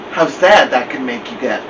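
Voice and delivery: usual speaking voice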